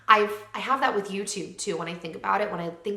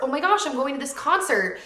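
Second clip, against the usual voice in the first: higher, louder thrilled gush; gushing excitement; 6 semitones higher, 5 dB louder than usual. Retold or imitated. Telling viewers about a concert invite, excited. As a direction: squeal it like big news. Gushing over friends' plans.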